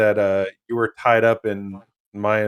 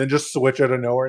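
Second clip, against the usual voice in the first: ordinary talk, nothing marked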